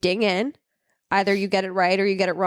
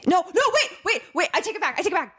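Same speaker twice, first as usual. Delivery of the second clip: high, rushed take-back; panicked; 11 semitones higher than usual. Real, not acted. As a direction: scramble to undo the answer. Panicked taking back a wrong answer.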